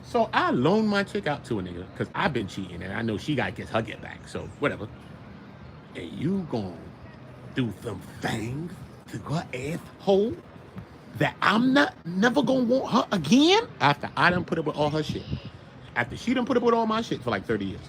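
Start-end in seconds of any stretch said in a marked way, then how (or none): 5.9-13.6 slow Southern drawl, menacing. Retold or imitated. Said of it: "I gotta put a Southern drawl on it".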